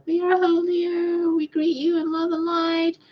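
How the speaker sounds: high, slow mock-angelic voice; mocking angel channeler. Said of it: "and they're like".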